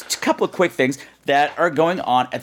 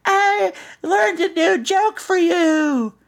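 put-on much higher, slow, sing-song little girl; much slower than usual. Katie greets Mister Plummet, a high little-girl voice. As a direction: chirp it like a six-year-old girl. Voicing six-year-old Katie.

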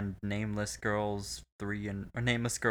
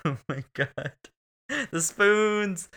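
shocked spoons; 9 semitones higher, 5 dB louder, slower than usual. Real, not acted. Sudden horrified recall.

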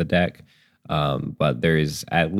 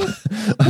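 high giggle; 13 semitones higher than usual. Real, not acted. Laugh before line.

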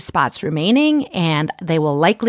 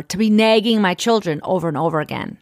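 usual speaking voice

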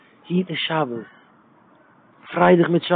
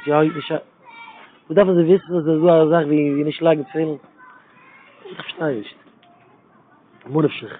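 ordinary talk

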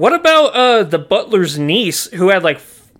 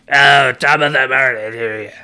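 lower, lightly put-on old smoker grandma doing an Irish accent; chain-smoking grandma; 4 semitones lower than usual. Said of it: "She sounds like my grandma".

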